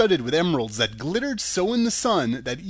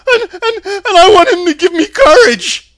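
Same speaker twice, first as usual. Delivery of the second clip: much higher and louder, stammering scared lion; Cowardly Lion; 14 dB louder than usual. A narrator's reading. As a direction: squeal it out like a frightened big cat.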